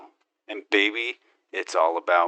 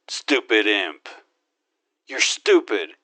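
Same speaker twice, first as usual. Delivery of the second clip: ordinary talk, nothing marked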